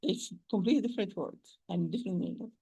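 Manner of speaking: usual speaking voice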